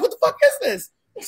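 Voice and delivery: retold frightened outburst; shocked cry. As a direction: freak out at the thing. Terrified at ghost.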